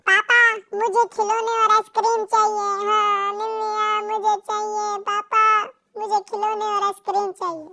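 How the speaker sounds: put-on high whiny little kid begging for toys; bratty kid nagging his father; child voice. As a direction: pester like a small child wanting ice cream.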